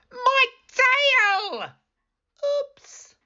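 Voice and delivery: high, squealing wolf yelp; wolf squeals, tail stepped on; pained wolf. A narrator's reading. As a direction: cry out as a hurt cartoon wolf.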